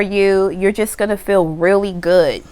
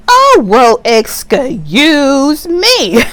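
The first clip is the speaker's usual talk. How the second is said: higher and louder, "excuse me" stretched very slowly; mock offended; 5 semitones higher, 6 dB louder, much slower than usual. Someone brushed aside by the client, hammed-up offended. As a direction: act scandalised, milk every syllable.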